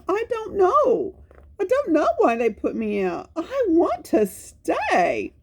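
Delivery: high, puzzled puppy voice with a laugh; the dog telling the children he doesn't know why; puzzled Spot. Acted. Spot complaining.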